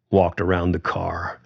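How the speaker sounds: usual speaking voice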